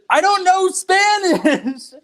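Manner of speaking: high, slow, exasperated mock yell; laughing protest. Boy insisting to the older gentleman, retold laughing wail. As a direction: drag out the protest while cracking up.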